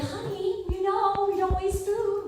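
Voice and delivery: high sing-song mother's scolding; sing-song mom. Retold or imitated. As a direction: lilt it like a mother chiding sweetly.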